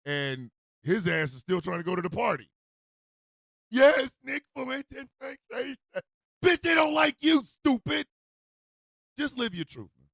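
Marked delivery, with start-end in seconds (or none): 3.7-10.1 high, loud scolding; exasperated mocking. Host telling him they don't like him, exasperated mocking. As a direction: tell him off in high-pitched disbelief.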